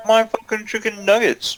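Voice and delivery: high, mock-outraged whine; indignant. Real, not acted. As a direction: defend the nuggets shrilly. Indignant defence of snack.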